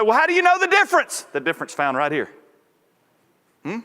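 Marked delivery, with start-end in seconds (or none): 0.0-1.2 high, loud challenging question; mock challenge, voice jumping up; acted challenge. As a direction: voice a doubter pressing the preacher, pitched high. Acted challenge from an imagined doubter.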